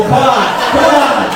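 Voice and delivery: loud, slow, egging the crowd on; rally the audience; calling out. Real, not acted.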